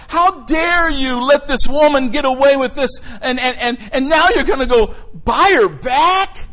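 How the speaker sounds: loud, high-pitched indignant scolding; judgmental outrage. Retold or imitated. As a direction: imitate scandalized critics berating a man. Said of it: "people judged Hosea like".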